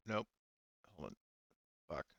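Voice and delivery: ordinary delivery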